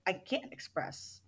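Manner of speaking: slow, breathy whisper of helplessness; whispered confession. Retold or imitated. Participant confessing she can't let anger out, whispered.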